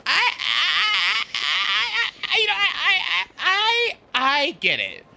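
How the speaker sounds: high, stammering fluster on every I; lost for words, stumbling over I in a high voice. Retold or imitated. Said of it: "You wanna know what he does?".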